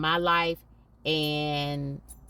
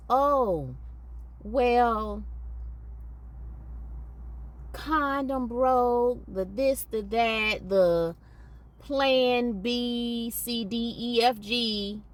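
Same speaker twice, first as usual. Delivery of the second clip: lightly mocking the excuse-makers, higher; 5 semitones higher, much slower than usual. Vlogger mocking careless parents' excuses, scoffing. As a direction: toss off their lame excuses in a scoffing voice.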